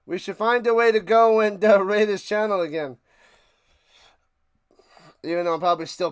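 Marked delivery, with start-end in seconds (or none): none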